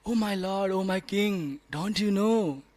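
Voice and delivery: a man mourning in mock grief to his king; imitating a grieving subject, voice breaking into laughter; mock sad lament. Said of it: "He said so sadly".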